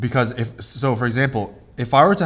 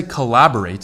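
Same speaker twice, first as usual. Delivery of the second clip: "collaborate" as cuh-LAB-orate; stress on second syllable; 5 dB louder than usual. Shown as a demonstration. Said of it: "for the verb".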